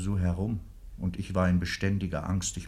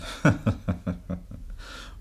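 breathy chuckle. Acted. Husband laughs at the memory.

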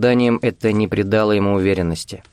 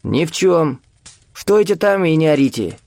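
put-on high-pitched old man's rebuff; tense, high old man's order; edgy old man; 7 semitones higher than usual. A narrator's reading. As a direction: snub him in a high, testy voice. Старик из укрытия осаживает Виктора.

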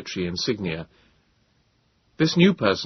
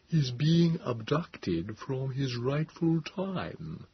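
slow, softer, otherworldly reasoning voice; 5 semitones higher, 5 dB quieter, much slower than usual. A narrator's reading. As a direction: reason with patient, slow, soft alien calm. Morlock continues.

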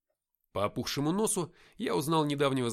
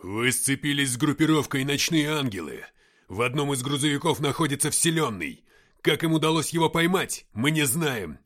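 loud, urgent radio report; shouted briefing; 3 semitones higher, 9 dB louder than usual. Acted. Ignat briefing the captain over radio, shouting. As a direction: call out intel over the noise.

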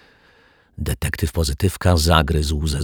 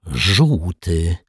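through clenched teeth. A narrator's reading. Detective insists on the colour, through clenched teeth. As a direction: squeeze the word out tightly. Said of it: "wycedził detektyw Pozytywka".

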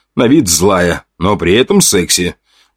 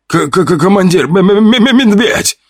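much higher, stammering in terror; panicked stammer; 12 semitones higher, much slower than usual. A narrator's reading. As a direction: stutter it out in a panic. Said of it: "запаниковали солдаты".

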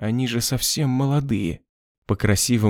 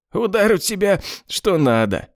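high mocking praise; 9 semitones higher than usual. Bloodied boss mock praise.

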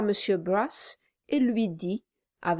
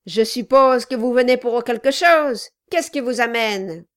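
gruff gravelly dwarf voice; 4 semitones higher, 8 dB louder than usual. Acted. Dwarf Kilp voiced in dialogue.

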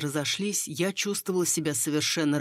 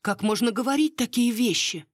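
high-pitched, scandalised question; indignant; 8 semitones higher, 4 dB louder than usual. A narrator's reading. Miss shocked by lady's remark, indignant. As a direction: protest with moderate outrage. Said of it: "возмутилась мисс Ферфакс".

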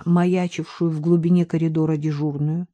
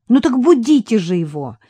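bright and higher, a little louder; cheerful; 5 semitones higher, 5 dB louder than usual. A narrator's reading. Katya cheerfully telling the men to wake him. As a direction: urge them on merrily. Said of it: "весело проговорила Катя".

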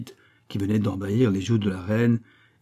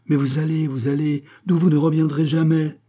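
high, rising in loudness to the end; urgent warning; 7 semitones higher, 5 dB louder than usual. A narrator's reading. Queen fears the king will never return, urgent warning. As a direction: press the warning, insistent and raised.

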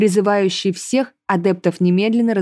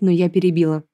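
usual speaking voice

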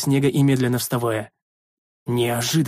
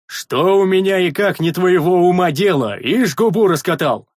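slow, loud, higher roar; angry scolding shout; 5 semitones higher, 5 dB louder than usual. Acted. Big man scolding a sentry, angry shout. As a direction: cut them down indignantly.

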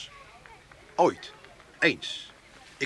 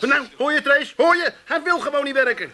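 calling out; 7 semitones higher, 4 dB louder than usual. Real, not acted. Calling wife to listen.

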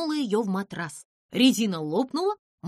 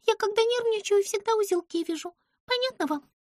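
high squeaky voice, quick and fussy; Pimpochka, fussy little creature; 10 semitones higher than usual. A narrator's reading. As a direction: play the tiny nervous girl. Pimpochka's voice held. (высокий писклявый голос)

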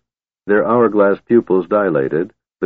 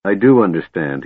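plain reading voice

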